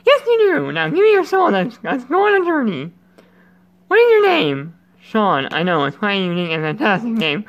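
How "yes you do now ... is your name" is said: high put-on character voice; playful high-pitched game character voice. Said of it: "I'm gonna try to do your voice".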